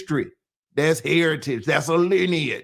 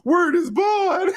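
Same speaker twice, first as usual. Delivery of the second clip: high, slow New York "Word is bond"; mock New York slang; 12 semitones higher, slower than usual. Teasing New Yorkers in the chat, high mock New York. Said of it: "Y'all were big on some word is bond shit".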